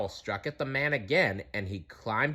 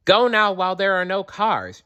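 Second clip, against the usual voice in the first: loud high call to the animals; 10 semitones higher, 4 dB louder than usual. A narrator's reading. Kestrel calling the animals across the road, loud and high. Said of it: "she called".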